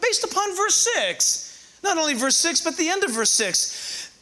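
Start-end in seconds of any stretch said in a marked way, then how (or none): 0.0-1.8 high, slow, Based punched; emphatic answer. Shown as a demonstration. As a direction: stress Based and slow right down. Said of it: "based upon what?".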